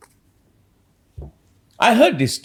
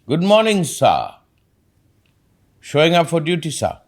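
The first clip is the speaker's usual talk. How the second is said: higher-pitched soldier reporting to a superior; military attitude; 6 semitones higher than usual. Acted. Soldier greeting a superior officer, military attitude. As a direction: answer like a soldier showing up for duty. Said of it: "Do you know the attitude?".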